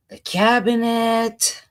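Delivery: normal talking voice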